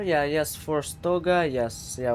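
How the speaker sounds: ordinary delivery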